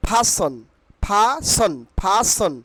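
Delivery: "ফাসন" said PHA-son, no r; careful model "ফাসন", pha then son; corrected pronunciation. Shown as a demonstration. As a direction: say "ফাসন" for repeating, puffed first sound. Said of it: "আমার সঙ্গে বলো".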